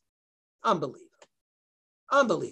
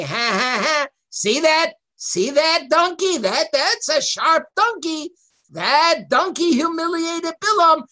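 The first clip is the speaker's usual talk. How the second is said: mocking gloat; 7 semitones higher, 5 dB louder than usual. People mocking Bilam, quoted.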